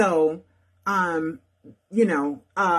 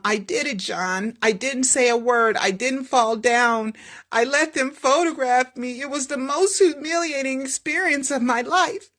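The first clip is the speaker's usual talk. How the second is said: hurt Michael; 6 semitones higher than usual. Acted. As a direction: hold back the humiliation. Michael after humiliating photographs.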